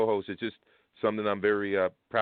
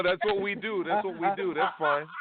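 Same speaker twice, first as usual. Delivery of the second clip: laughing; 10 semitones higher than usual. Real, not acted. Laughing along at teasing.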